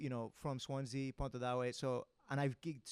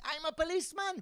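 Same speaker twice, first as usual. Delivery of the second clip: much higher, exasperated cry; indignant sheriff; 4 dB louder than usual. Acted. First policeman explaining himself to the town, indignant. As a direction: pitch it right up, insisting on your authority. Sheriff answers townspeople asking why.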